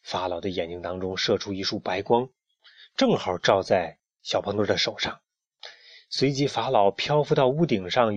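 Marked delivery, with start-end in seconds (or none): none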